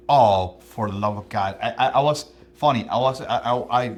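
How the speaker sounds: loud, excited outcry; excited outburst. Real, not acted. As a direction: burst out with delight.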